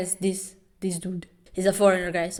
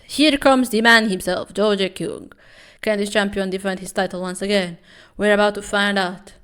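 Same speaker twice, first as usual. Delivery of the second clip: louder hyped entrance call; ring announcer; 7 dB louder than usual. Acted. Vlogger calling the champion's entrance, louder announcer style.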